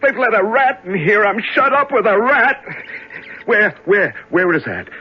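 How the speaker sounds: frantic, high-pitched panic; breathless fear, pitched well up; panicked search. Acted. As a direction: hunt wildly for the rat, terrified. Panicked prisoner hunting a rat.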